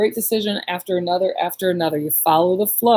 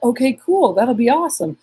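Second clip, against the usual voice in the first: higher, cheerful retold yes; upbeat agreement; 6 semitones higher, 3 dB louder than usual. Daughter going along with mom's dinner plan, upbeat. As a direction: agree readily, pitched up. Retelling her easy agreement.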